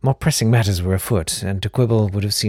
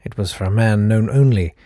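plain reading voice

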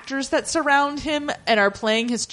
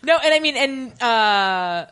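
normal talking voice

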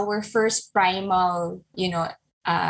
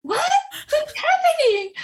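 much higher, startled disbelief; shocked; 3 dB louder than usual. Retold or imitated. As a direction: caught completely off guard. Mom shocked by daughter's words.

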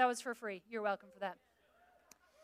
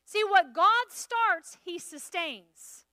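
preaching; 4 semitones higher, 8 dB louder, much slower than usual. Acted. Preaching sermon to congregation.

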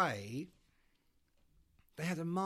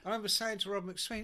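ordinary talk, nothing marked

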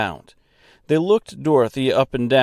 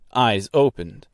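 ordinary delivery

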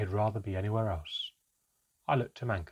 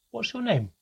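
calling out; 12 semitones higher, 4 dB louder than usual. A narrator's reading. Calling after departing elf.

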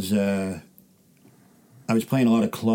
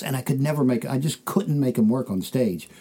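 ordinary talk

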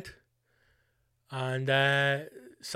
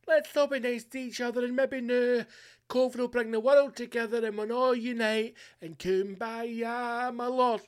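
mocking naive optimism; 7 semitones higher than usual. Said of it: "past the stage of".

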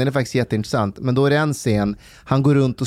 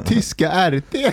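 laughing; 9 semitones higher than usual. Real, not acted. Laughing at Paludan's RT mix-up.

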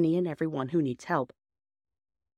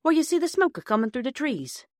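higher-pitched, mildly grumpy countryman; mildly grumpy signalman; 7 semitones higher, 3 dB louder than usual. Acted. Signalman grudgingly directing the children to a farm, mildly grumpy. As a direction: give directions with growing grumpiness. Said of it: "more and more grumpy".

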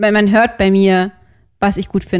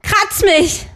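called out on request; 9 semitones higher, much slower than usual. Pretend. Guest reading dirty lines aloud on request, called out. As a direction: bark the lines like an order. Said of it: "Kannst du es mal rufen?".

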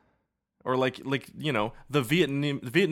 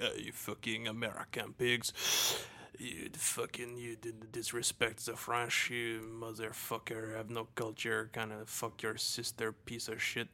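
a softer, muttered rant as a snooty Frenchman; mock French insult; 2 semitones lower, 12 dB quieter than usual. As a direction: grumble the insults like a disdainful Frenchman, under your breath. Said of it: "the French would be like".

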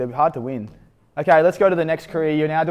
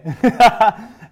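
loud laugh; 9 semitones higher, 9 dB louder than usual. Real, not acted. Host laughing at his own retold line, loud real laugh. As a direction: burst of loud, higher laughter.

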